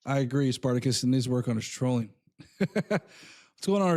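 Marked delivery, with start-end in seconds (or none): 2.3-3.9 slow, drawn-out laugh into a greeting. Real, not acted. Host greets the new guest while still laughing. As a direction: still chuckling, let the laugh carry the words.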